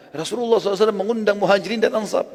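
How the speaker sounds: high-pitched, flustered report; imitating alarm, pitched well up; acted dismay. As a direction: break the bad news as a rattled husband. Acted flustered husband reporting to wife.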